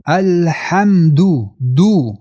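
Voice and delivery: slow 'الحمد' ending in a full du; clear damma. Shown as a demonstration. Teacher shows the damma, clear slow vowel. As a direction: round out the 'دو' distinctly.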